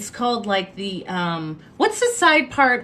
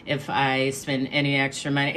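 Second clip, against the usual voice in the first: much lower, even, droning voice with no lift; monotone friend; 8 semitones lower, 5 dB quieter than usual. Retold or imitated. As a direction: drone out every excuse on one low note. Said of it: "she speaks in this very monotone voice".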